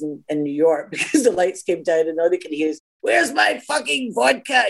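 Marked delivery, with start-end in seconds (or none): 3.0-4.7 put-on high, drawn-out drunken holler; slow, pitched-up mock yell; drunk party screaming. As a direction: bellow like a Glasgow party drunk, hammed up. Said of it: "everybody's drunk, screaming at each other".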